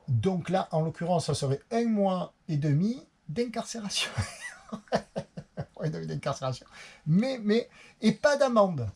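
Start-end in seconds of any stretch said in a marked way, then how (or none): 1.7-6.7 laughing. Real, not acted. Laughs repeating his sentence. 7.1-8.9 excited. Real, not acted. Relief at no fine.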